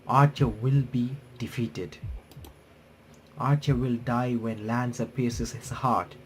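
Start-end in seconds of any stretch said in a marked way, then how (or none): none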